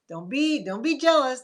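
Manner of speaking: a cheeky don't be jealous; sassy mock taunt. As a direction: tease them with a sing-song brag. Sassy comeback to coworker.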